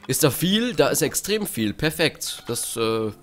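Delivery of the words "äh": ordinary delivery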